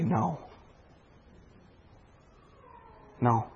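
quiet, gentle, final refusal; soft firm no. Acted. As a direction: let the no fall softly but firmly. God's answer, said softly.

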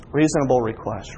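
normal talking voice

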